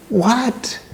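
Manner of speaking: high-pitched, incredulous "what"; shocked outrage. Retold or imitated. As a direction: act out a stunned, angry father. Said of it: "he's very angry".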